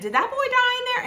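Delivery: high, fast incredulous question; alarmed disbelief. Retold or imitated. Teller voicing what was in her mind, alarmed disbelief. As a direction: race up high in retold alarm.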